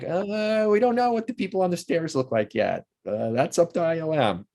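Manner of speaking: mock an unsure crew passing the buck; high-pitched hemming and hawing imitation; clueless crew. Crew dodging the question, voiced.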